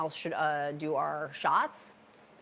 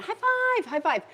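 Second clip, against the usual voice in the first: high-pitched, excited congratulations; squealed, gleeful high five; celebratory cheer; 4 dB louder than usual. Real, not acted. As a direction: whoop it up high for a viewer's win. Cheering a viewer's weight loss.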